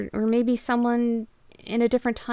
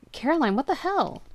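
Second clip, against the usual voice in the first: higher, louder startled cry to his wife; jolted awake and shouting; alarmed shout; 7 semitones higher, 5 dB louder than usual. Acted. Husband yelling at his sobbing wife, alarmed.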